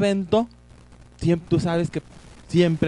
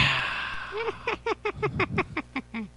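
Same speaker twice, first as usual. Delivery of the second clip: evil cackle; 7 dB quieter than usual. Acted. Horror host cackles after intro.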